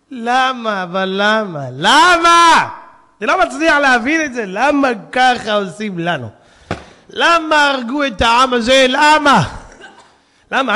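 loud, pleading cries of why; anguished outcry. Real, not acted. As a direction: cry out to heaven in pain. Preacher crying out in lament.

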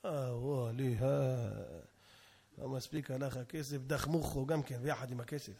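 low, quiet, drawn-out lament; sighing, worn-down wife; resigned wife. Acted. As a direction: mutter it low and soft, giving up. Wife sighing at departing husband.

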